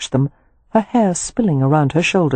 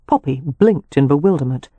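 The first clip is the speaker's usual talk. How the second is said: ordinary delivery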